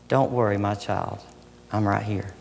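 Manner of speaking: soft reassurance. Acted. God softly comforting his child in the poem. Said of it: "Your loving voice whispered softly".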